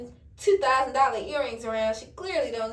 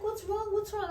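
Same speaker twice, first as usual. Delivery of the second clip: imitating boyfriend; 8 semitones higher, 4 dB quieter than usual. Boyfriend voiced asking innocently.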